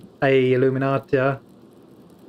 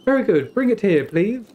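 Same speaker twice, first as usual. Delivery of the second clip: a hint of a higher, prim monk voice; imitating Brother Guy; 8 semitones higher, 3 dB louder than usual. Streamer imitating Brother Guy's prim way of speaking. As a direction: mimic the monk's prim politeness. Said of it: "talks like this".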